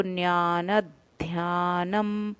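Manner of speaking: "ज्ञान ध्यानम" very slowly, half d then full dh; slow clear pronunciation. Shown as a demonstration. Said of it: "द का उच्चारण हमें आधा करना है".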